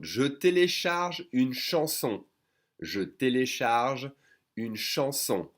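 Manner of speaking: «Je télécharge une chanson» slowly, je fully sounded; careful slow version. Shown as a demonstration. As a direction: spell out each word of «Je télécharge une chanson» unhurriedly. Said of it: "If I say it faster".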